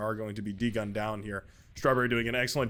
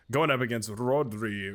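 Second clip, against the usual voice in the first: ordinary talk, nothing marked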